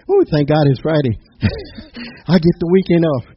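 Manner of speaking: hammed-up relief that the weekend has come; mock Friday joy. Mock weekend-happy worker quote.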